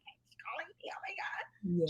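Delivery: hushed, secretive whisper; retold whisper. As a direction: whisper it like a private aside. Retells whispered aside about her EP.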